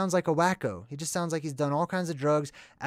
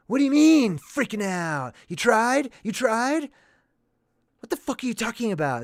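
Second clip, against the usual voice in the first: higher, louder mock rant, faster at the end; Nick Cage freaking out; 8 semitones higher, 6 dB louder than usual. Father yells at daughter, hammed-up Nick Cage rant. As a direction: rant at your daughter in disbelief. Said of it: "This is Nick Cage classic freaking out".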